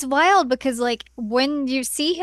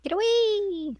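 high squeaky cry; 10 semitones higher, slower than usual. Acted. Mock fleeing wolves cry.

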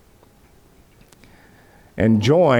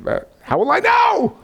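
high pleading, much higher and strained; mock-panicked Abraham crying out for proof; hammed-up high anxious squeak. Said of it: "he said".

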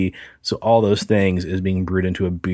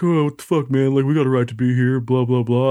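higher, sneering bro comeback; mock bro voice; 4 semitones higher than usual. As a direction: play the annoyed bros brushing him off. Bros mouthing back at him.

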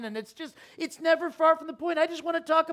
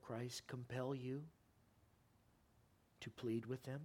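much lower, much softer closing appeal; quiet appeal; 13 semitones lower, 13 dB quieter than usual. Acted. Pastor softly urging the church to share faith, hushed appeal. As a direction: draw them in gently, pleading.

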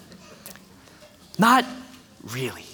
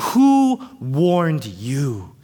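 John the Baptist questioning; 4 semitones lower, much slower than usual. Acted. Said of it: "John said".

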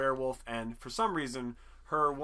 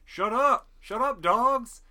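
high, loud yell out the window; retold neighbor shouting at barking dogs; 8 semitones higher, 9 dB louder, much slower than usual. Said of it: "he opens the window and he's like".

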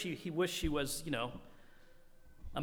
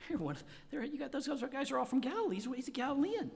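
higher, lightly voiced little girl pointing; a hint of an eager girl; 9 semitones higher, faster than usual. Girl accusing Peter, voiced.

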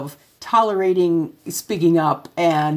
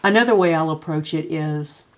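ordinary talk, nothing marked

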